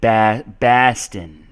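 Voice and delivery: "Baston" slow, the O turned into a short A; Boston accent. Shown as a demonstration. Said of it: "they say Baston".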